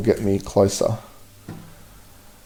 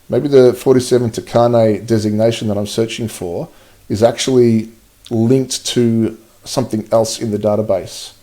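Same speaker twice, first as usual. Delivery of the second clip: ordinary talk, nothing marked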